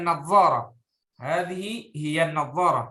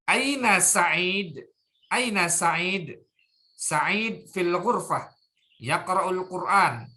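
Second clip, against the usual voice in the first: slow model reading; slower than usual. Shown as a demonstration. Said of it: "tabadalis su'ala wal jawaba".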